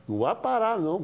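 ordinary talk

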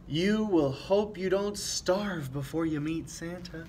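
ordinary delivery, nothing marked

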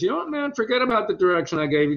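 high, quick comedian impression; Jerry. Jerry withdraws his direction on set, imitated high voice. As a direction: leap up in pitch and rattle it off, imitating him. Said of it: "Jerry just came over and he goes".

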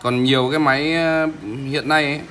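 ordinary talk, nothing marked

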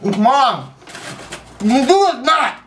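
talking through a mouthful of crackers; eating dry crackers. Real, not acted. Mid Saltine Challenge, mouth stuffed.

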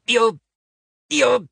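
a giant choking on his own pride; indignant stammer, voice rising; outraged sputter. Acted.

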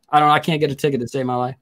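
ordinary talk

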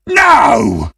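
hammy howl of protest; anguished shout. Acted. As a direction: wail it as the game is lost. Reacts to losing the game.

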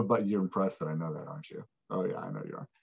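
low, soft, smug tease; teasing. Acted. Teacher teasing viewers who are impressed, low and smug. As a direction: dip the voice low and tease them.

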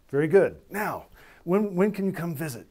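loud, high, eager invitation; delighted approval. Retold or imitated. Minister to his new "brother" after memo plea, delighted. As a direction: imitate a pleased host brushing business aside.

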